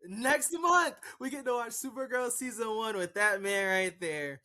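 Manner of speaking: high, loud, excited shout; excited announcement. Real, not acted. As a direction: shout the big news with real excitement. Excitedly announcing next month's show.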